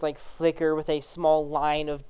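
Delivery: normal talking voice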